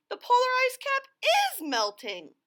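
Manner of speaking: a fox changing its story fast; high flustered fox; rattled fox. Acted. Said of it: "howled Foxy Loxy".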